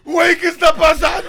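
shouted disbelief. Retold or imitated. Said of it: "Y yo así".

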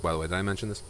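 usual speaking voice

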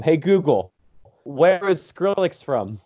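slow, clear command to a speaker; slow, carefully said command to Google Home. Real, not acted. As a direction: spell it out slowly for the machine. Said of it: "I can use my Google Home".

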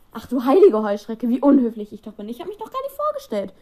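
loud, high, effusive motherly witch; gushing Molly Weasley. Acted. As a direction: burst out in a loud high fluster. Molly greets Helena effusively.

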